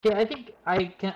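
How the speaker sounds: normal talking voice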